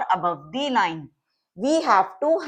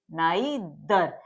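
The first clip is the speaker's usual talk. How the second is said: Neither drawn out slowly, low and softer; slow sounding-out; 5 semitones lower, 4 dB quieter, much slower than usual. Shown as a demonstration. Teacher sounding out the example word for students, slowly. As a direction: say neither slowly in two parts.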